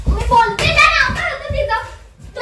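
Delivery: angry insistence. Acted. Wife demands the other girl's name, angry and high. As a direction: press the question, voice climbing.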